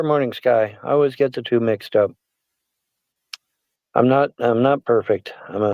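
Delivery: ordinary talk, nothing marked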